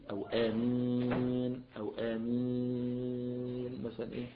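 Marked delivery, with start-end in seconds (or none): 0.3-1.6 آمين with the ي stretched slowly to four counts. Shown as a demonstration. Teacher showing the middle length of ي in آمين, drawn out. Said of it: "ممكن يقول مثلًا". 2.0-3.8 آمين with the ي held very long for six counts. Shown as a demonstration. Teacher showing the longest ي in آمين, chanted. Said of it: "ست حركات".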